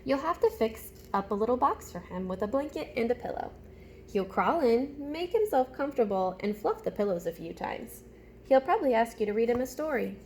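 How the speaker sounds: put-on animated storytime voice for young listeners; reading a picture book aloud. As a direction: read it like story time with little ones. Reads picture book to class.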